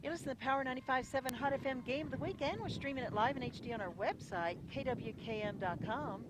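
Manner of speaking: quick, upbeat announcer read; radio station plug. Acted. Announcer to radio listeners, upbeat station read. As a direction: sell the station ident fast and bright.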